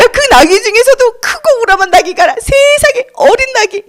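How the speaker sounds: high, mocking laughter-filled voice; incredulous, hammed-up ridicule; scoffing onlookers. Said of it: "사람들 그렇게 생각했어요".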